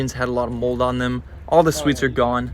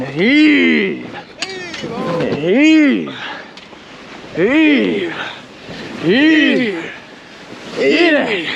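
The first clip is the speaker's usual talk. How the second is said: rhythmic hauling chant, building higher; tug of war; 7 semitones higher, much slower than usual. Real, not acted. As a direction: call out each heave in time. Hauling the sail down together.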